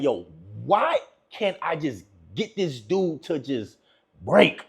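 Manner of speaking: slow, drawn-out frustrated plea; mock exasperation. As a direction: imitate a stumped woman, low and slow. Voicing women's frustration at him.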